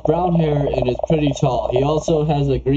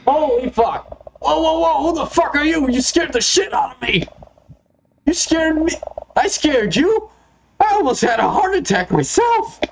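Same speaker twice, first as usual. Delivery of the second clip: high-pitched acted fright; startled outburst; 11 semitones higher than usual. Two startled strangers meeting at a tent, high alarmed exclaiming. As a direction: jump out of your skin, pitch shooting up.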